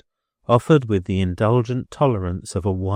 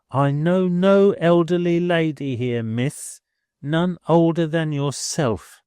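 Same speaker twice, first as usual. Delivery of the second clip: higher, haughty landlady voice; lofty landlady; 7 semitones higher than usual. A narrator's reading. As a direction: snub her grandly as the landlady. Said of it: "loftily cancelling Miss Twinkleton".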